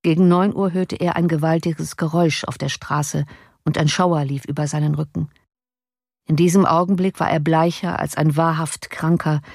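usual speaking voice

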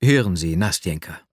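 tief und fallend, bestimmt; sehr entschieden. A narrator's reading. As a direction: setz entschlossen zum Reden an. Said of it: "sagte ich sehr entschieden". (bestimmt, in tiefer Lage)

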